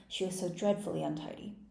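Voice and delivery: ordinary delivery, nothing marked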